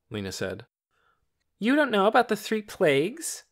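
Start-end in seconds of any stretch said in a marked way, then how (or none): 1.6-3.4 high, loud, mock-astonished question; smug boy feigning disbelief; exaggerated surprise. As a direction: pretend to be shocked she doesn't know. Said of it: "in a tone of exaggerated surprise".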